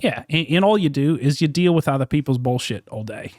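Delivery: put-on Aussie accent, held steady; Australian accent. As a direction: fake Australian accent in character. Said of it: "continued the Australian accent".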